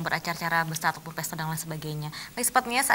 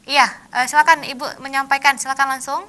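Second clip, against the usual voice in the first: calling out; 6 semitones higher, 10 dB louder than usual. Real, not acted. Host calling out to caller.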